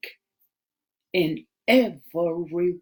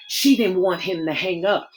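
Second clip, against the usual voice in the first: ordinary delivery, nothing marked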